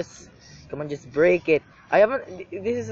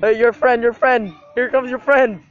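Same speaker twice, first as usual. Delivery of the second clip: taunting shout; 5 semitones higher, 6 dB louder than usual. Real, not acted.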